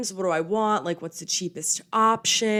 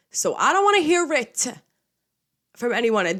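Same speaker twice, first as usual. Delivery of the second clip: defensive outburst; 6 semitones higher, 5 dB louder than usual. Real, not acted. Defensive outburst about mistakes.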